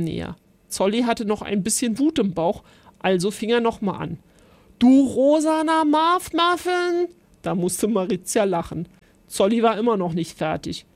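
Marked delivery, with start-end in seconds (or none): 4.8-7.1 higher, louder, slow silly insult; child hurling a funny insult at friend; gleeful shout. A narrator's reading.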